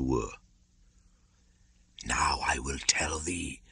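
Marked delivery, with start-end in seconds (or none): 2.0-3.6 breathy unvoiced whisper. A narrator's reading. Kaa beginning his tale to Mowgli, whispered. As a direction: whisper it close. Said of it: "Kaa whispers unvoiced".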